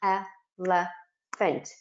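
"Elephant" drawn out very slowly, syllable by syllable; a small child tapping out "Elephant" in slow beats; syllables tapped slowly. Retold or imitated. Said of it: "and they'll go".

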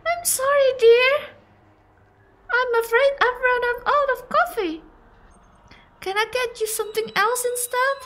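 high-pitched granny hostess; apologetic old lady. Acted.

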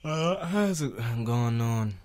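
slow, stretched-out reply; drawn-out. Pretend. Answering co-host's prompt, drawn-out. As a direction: drag the words out oddly.

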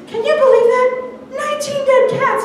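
put-on gasping at the TV with boyfriend; disbelieving retell; shocked to boyfriend. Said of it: "and I went".

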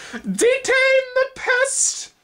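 very high, very slow, loud shrill cry; shrill order to attack; shrill insect cry. A narrator's reading. Gardener insects rallying the grubs, shrill slow cry. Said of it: "came the shrill cry of the gardener insects".

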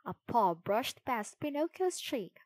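plain reading voice

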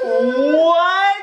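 loud high gasp then a shocked what; gasp of surprise. Real, not acted. Dad unboxing, startled as it pops out, loud gasp. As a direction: caught off guard, gasp out loud.

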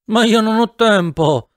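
words dragged and half-chewed; slurred mumble. A narrator's reading. Drugged narrator slurring a protest to the women.

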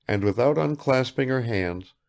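ordinary talk, nothing marked

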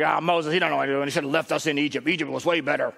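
low, fast mock complaint; grumbling. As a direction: imitate grumbling followers, quick and low. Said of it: "a generation that grumbled and murmured".

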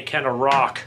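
ordinary talk

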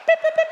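squeaky high alarm beeps, loud; high beeping alarm. Retold or imitated. Imitated alarm noise, very high.

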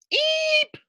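tiny high eep of fright; startled squeak. Acted. Little girl startled by the lizard squeaks.

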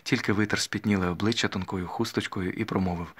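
ordinary delivery, nothing marked

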